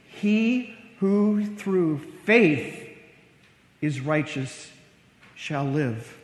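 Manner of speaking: slow, deliberate recitation with pauses; measured reverent quoting; solemn scripture quote. Acted. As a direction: weigh each phrase of the verse, dropping low at the end. Solemnly quoting the key verse.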